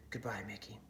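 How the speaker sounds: soft hushed farewell; whispered goodbye. A narrator's reading.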